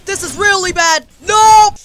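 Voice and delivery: high, very loud alarm; panicked shouting. Real, not acted. Player seeing it go really bad, panicked shouting. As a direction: shout in mounting panic to a scream. Panic as fight goes wrong.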